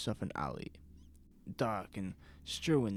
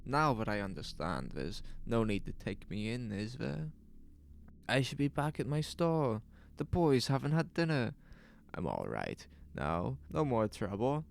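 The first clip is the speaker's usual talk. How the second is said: higher, eager and apologetic; anxious to be let go home; flustered shopkeeper; 5 semitones higher than usual. Acted. Shopkeeper pleading with the officials to release him, higher and anxious. Lois excusing himself to police.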